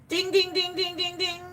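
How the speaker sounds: high, bright bell chime ding; game show bell. Acted. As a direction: sing it like a winning quiz bell. Correct answer, game-show bell.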